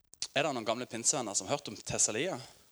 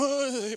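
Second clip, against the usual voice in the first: a high, loud hammed-up "Åh!" as someone topples backwards; mock cry; 14 semitones higher, 6 dB louder than usual. Preacher mocks unhealthy revival antics with a yelp. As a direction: ham up the fall with a yelp. Mimicking someone falling back.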